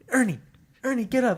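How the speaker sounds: put-on high, urgent kid voice; excited kid wake-up. Children waking their sleeping stepmom, high and eager. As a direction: rouse her like an eager child.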